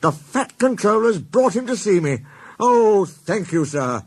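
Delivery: delighted and breathless with gratitude; overjoyed thanks. A narrator's reading. Gordon thanks the Fat Controller for Flying Scotsman.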